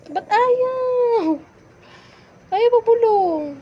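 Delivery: loud, high, drawn-out complaint; whiny protest. Real, not acted. Protesting that listener refuses the whisper.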